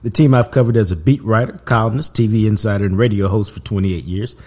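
loud, punchy show-opener delivery; podcast intro read. Acted. Host introducing his Cowboys podcast to listeners, punchy intro read. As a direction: announce the show with loud, driving energy. Reads the show's opening intro.

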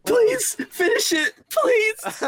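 high, squeaky plea through laughter; real laughing fit squeezing the words; helpless laughter. Real, not acted. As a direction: beg while cracking up.

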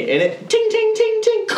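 high pinging ting sounds; ping it like metal bouncing; grenade ting noises. Retold or imitated.

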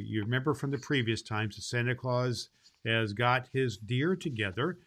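usual speaking voice

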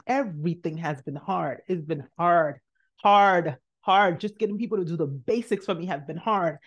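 ordinary talk

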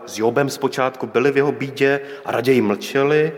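usual speaking voice

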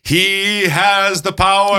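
high, slow booming cartoon hero voice; He-Man impression. Host booming a cartoon hero's catchphrase in a high, slow voice. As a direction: proclaim it like a cartoon superhero, high and drawn out. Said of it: "He-Man, the cartoon".

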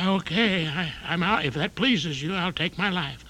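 put-on a frail old man resigning himself; elderly tap dancer giving up. Old dancer giving up in the elevator, high fading voice. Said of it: "An elderly tap dancer trapped in an elevator".

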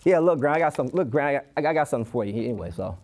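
low, trailing-off, half-hearted mumble; unenthusiastic child. Acted. Child grudgingly handing Gran a gift, unenthusiastic. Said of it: "Or even worse, what if the child was like".

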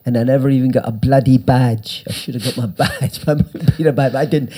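ordinary delivery

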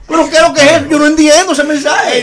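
mock exasperated shout. Joking panelist demands the host explain the parable, mock shout. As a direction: throw up your hands in hammed-up confusion. Comic bafflement over the message.

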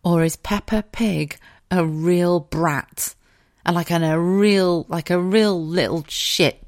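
normal talking voice

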